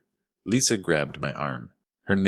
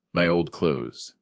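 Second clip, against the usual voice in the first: ordinary delivery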